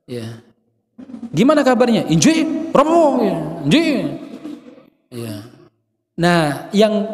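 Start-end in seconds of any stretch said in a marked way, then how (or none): 1.3-3.2 high-pitched demo of bad tone; high intonation. Shown as a demonstration. Preacher mimics good words in bad tone, high intonation. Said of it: "intonasinya tinggi, atau intonasinya kurang baik". 3.7-3.8 short rising mock reply; high intonation. Shown as a demonstration. Preacher mimics polite word in bad tone, high intonation. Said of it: "intonasinya tinggi, atau intonasinya kurang baik".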